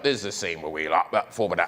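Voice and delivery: low, garbled British commentary; mumbled British accent. Acted. Film-maker giving audio commentary, mumbled British accent. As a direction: let the British words slur into mush. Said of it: "the auto commentary is terrible".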